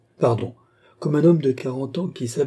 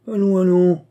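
paternal; 8 semitones higher, 7 dB louder than usual. A narrator's reading. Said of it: "fit Grimbaud devenu paternel".

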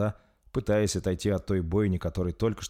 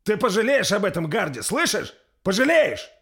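high, loud cry after someone leaving; threatening shout; 13 semitones higher, 7 dB louder than usual. A narrator's reading. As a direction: yell the threat at his back. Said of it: "закричал вдогонку уходящему лорду Рольф". (угрожающий крик вдогонку)

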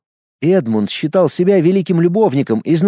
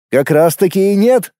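higher, cheerful contradiction; pleased, pitched-up reply; smiling rebuttal; 6 semitones higher, 3 dB louder than usual. A narrator's reading. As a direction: disagree with a smile. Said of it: "улыбнулся он в ответ".

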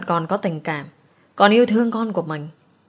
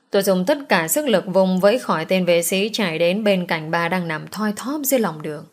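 usual speaking voice

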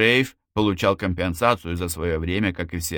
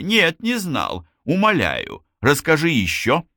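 higher-pitched mock amazement, playing along; feigned interest, disbelief; 8 semitones higher, 4 dB louder than usual. A narrator's reading. Руза plays along with Лазло's mushroom talk, feigning interest and disbelief. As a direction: beg to hear more with put-on fascination. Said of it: "изобразив интерес и недоверие".

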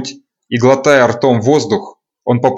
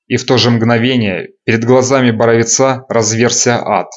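usual speaking voice

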